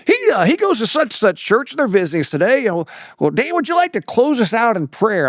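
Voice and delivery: high, folksy mock preacher; lightly hammed country preacher asking for a prayer; imitating the preacher. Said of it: "And he goes".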